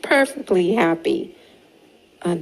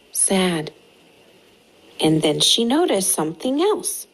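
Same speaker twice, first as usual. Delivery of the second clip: usual speaking voice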